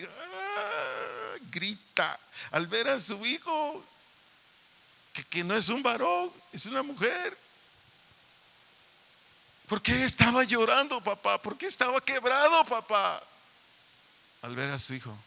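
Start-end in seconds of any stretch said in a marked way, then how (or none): 0.0-1.3 sudden high wailing cry of a weeping father; imitated anguished cry. Pastor imitates a weeping father in court, high anguished cry. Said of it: "llanto así". 9.7-13.2 higher, louder pleading questions to the congregation; impassioned preaching. Acted. Pastor challenges parents about a weeping father, fervent preaching.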